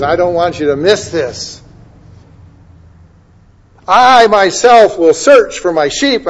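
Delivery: loud, high preaching proclamation; preaching scripture reading. Acted. Preacher proclaiming God's promise to the flock, loud. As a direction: declare God's promise with full force. Preacher reads Ezekiel passage.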